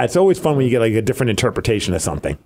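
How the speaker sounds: usual speaking voice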